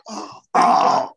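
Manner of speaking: voiceless mock gasp of relief; loud, breath-only imitation of astonishment; mimicked stunned gasp. Coach imitating the relieved referee, stunned gasping. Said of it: "I'll never forget your face".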